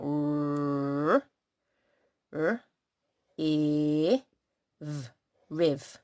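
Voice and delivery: R, r, e, v, riv said as separate letter sounds, then blended into riv; sounding out riv. Shown as a demonstration. Teacher sounding out riv for the children, letter by letter. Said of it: "we can sound that out".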